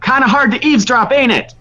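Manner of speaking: high, fast taunting call; shouting illusion voice. Acted. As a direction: taunt them from behind the wall. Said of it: "his voice shouting".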